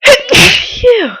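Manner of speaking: big real sneeze. Real, not acted. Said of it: "I'm gonna sneeze again".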